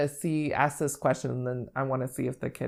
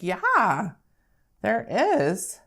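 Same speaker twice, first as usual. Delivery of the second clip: put-on bright, higher praise for a child's answer; modelled praise; 5 semitones higher, 4 dB louder, much slower than usual. Shown as a demonstration. Teacher praising a child's guess, encouraging. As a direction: reward the child's answer eagerly. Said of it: "I'll be like".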